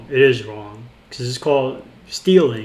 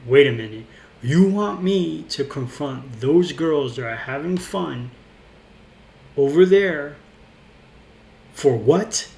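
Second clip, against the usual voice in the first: slow, louder, rising on over there; mock bar manager getting louder; louder repeat. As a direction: hammer the same question harder. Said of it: "he repeats that same line again".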